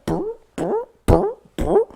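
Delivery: high buzzing brr noises for punches; punch sound effects. Retold or imitated. Describing the fight, mimicking high punch noises. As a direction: buzz out the punches like blows landing.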